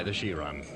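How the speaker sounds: "the Chiron" with "Chiron" said like the word "she", a posh Norwegian ski. Acted. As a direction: say "Chiron" as "shee", never "skee". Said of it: "That's the right way to pronounce S K I".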